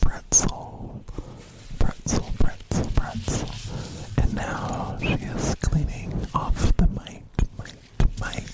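loud close-mic whisper; ASMR whisper. Real, not acted. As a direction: whisper each word into the mic. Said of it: "I was, like, really whispering".

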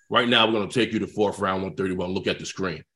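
very low, softened authority; low, unhurried coach on the phone; imitated coach. Coach telling rookie of his pick, low and authoritative.

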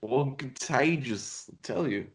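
a stretched-out hint of Walken; halfway Christopher Walken. Co-host drifting into a slow Walken-like voice. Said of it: "You sound halfway to Christopher Walken".

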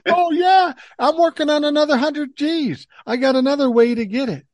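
string the scammer along with a silly brag; mock bragging.

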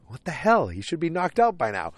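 shocked disbelief. Retold or imitated. Hitchhikers baffled that Don is still standing, shocked disbelief. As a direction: play it like the drugged man should have dropped already.

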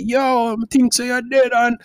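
high, excited Jamaican patois; Jamaican accent. Acted. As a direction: imitate a Jamaican barber teasing a runaway client. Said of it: "you know Jamaican".

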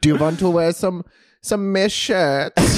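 high, slow mock German accent; camp German accent. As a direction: draw out a hammed-up German accent.

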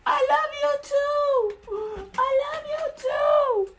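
very high, slow, hammed-up tearful reply; God crying back. God answers the snotty prayer, high mock sob. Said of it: "God is like".

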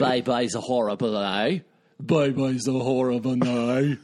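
put-on hammy jokey Australian accent. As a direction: lean into a bad Australian accent. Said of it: "From the Australian outback".

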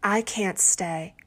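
ordinary delivery

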